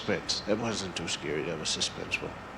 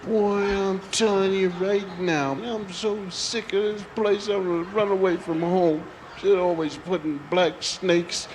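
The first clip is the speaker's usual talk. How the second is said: put-on higher, slow sulking child; sulky kid; 5 semitones higher than usual. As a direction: grumble like a grounded kid. Child alone in crib, sulking.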